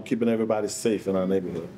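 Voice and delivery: ordinary delivery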